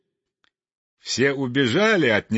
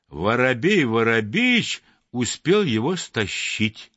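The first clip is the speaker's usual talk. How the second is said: usual speaking voice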